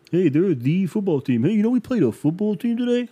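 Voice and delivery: mocking joke voice. Vlogger mocking other fans' football team jokes, high and silly. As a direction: ham up the lame joke with a squeaky lilt. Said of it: "I'm not gonna put you through the jokes".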